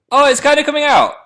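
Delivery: high, loud delighted squeal; thrilled as the picture appears; excited. Real, not acted. Watching his instant photo develop live, a high, loud excited squeal.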